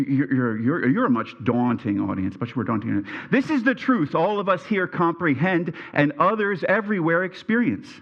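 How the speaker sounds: ordinary talk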